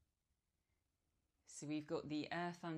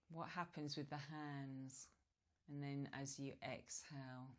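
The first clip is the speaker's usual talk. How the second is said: guided meditation. Real, not acted. Yoga teacher guiding relaxed breathing, calm meditation voice. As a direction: ease them gently into stillness, leaving space between phrases.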